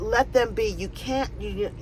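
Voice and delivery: ordinary talk, nothing marked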